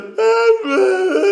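high, loud broken blubbering sobs; mock blubbering cry. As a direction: imitate yourself falling apart in tears. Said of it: "I'm like a blubbering guy".

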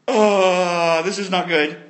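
long drawn-out "oh" of sinking dread; mock dismay. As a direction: act out the brothers' alarm, lightly. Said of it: "they all went".

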